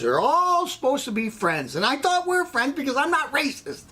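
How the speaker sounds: high-pitched comic indignation; hurt, worked-up protest; wounded protest. Acted. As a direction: plead your innocence with the pitch climbing. Protests betrayal by supposed friends.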